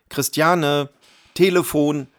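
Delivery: husband yelling to his wife about the phone; calling loudly. A narrator's reading. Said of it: "rief er so laut er konnte".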